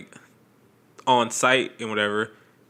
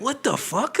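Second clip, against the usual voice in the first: incredulous; 10 semitones higher, slower than usual. Retold or imitated. Incredulous retold reaction.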